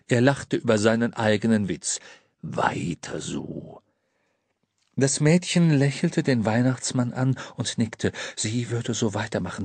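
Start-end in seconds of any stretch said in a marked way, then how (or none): 2.4-3.8 low, slow, soft encouragement; deep Santa voice. A narrator's reading. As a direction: nod her on gently.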